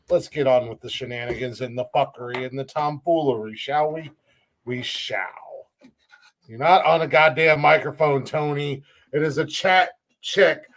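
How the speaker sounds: normal talking voice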